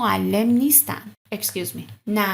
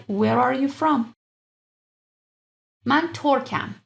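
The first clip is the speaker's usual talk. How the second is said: ordinary delivery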